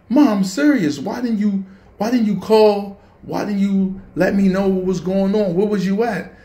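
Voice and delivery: high, loud upset questioning; angry pleading. Retold or imitated. Worried son confronting his late mom, angry and high. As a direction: press her for answers, high and heated. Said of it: "and I am furious".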